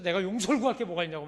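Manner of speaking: huffing angry retort. Retold or imitated. Son storming out on the old missionary, huffing and loud. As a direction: spit it out while fuming. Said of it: "막 씩씩거리면서".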